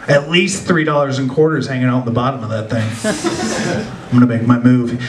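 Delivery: low, scheming mugger voice. Retold or imitated. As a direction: play the would-be mugger sizing her up. Mugger's inner voice.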